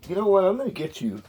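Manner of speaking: high, teasing voice for the cat; sing-song threat to a kitten; playful pet talk. Real, not acted.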